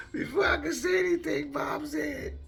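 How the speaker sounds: high, loud, squeezed out through laughter; laughing while talking. Real, not acted. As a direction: barely get the words out for laughing. Laughing through his own story.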